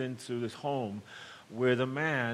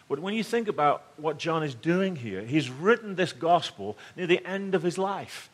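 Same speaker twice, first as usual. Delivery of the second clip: ordinary delivery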